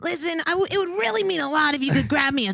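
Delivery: lightly voiced laid-back guy; impression of boyfriend. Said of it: "He talks like".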